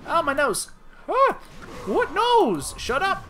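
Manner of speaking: squeaky high bear yelling. Pretend. Bear snapping back at the streamer, squeaky and loud. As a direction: yelp it out high and sharp.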